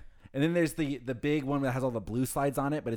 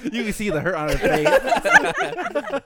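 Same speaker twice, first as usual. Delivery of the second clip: high, loud, slow, through laughter; teasing while cracking up; laughing tease; 13 semitones higher, 11 dB louder, much slower than usual. Real, not acted. Teasing her girlfriend about Soak City, laughing.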